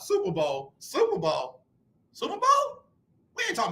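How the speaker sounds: high, squeaky mock question; mock the very idea of it; mocking incredulity. Real, not acted. Mocks Texans fans.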